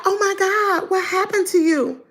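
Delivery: put-on high voice of shocked concern; imitated shock. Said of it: "she said it like she was shocked".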